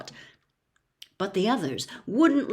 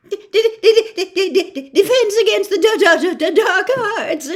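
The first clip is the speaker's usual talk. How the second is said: higher and louder, stuttering with nervous laughs; stammering mutter; 11 semitones higher, 11 dB louder than usual. Acted. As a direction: dread tripping him on every syllable. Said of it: "muttered Professor Quirrell".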